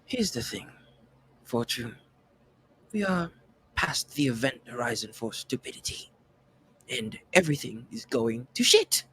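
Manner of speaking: soft-spoken British character voice; measured, understated British delivery; British accent. Acted. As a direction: play it quietly in a British accent, pausing between phrases. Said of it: "my British accent makes the R hard".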